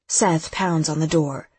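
ordinary delivery

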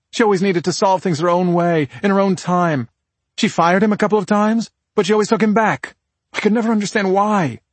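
high, insistent woman's character voice; defensive sister. Acted. Voices sister explaining victim's choices.

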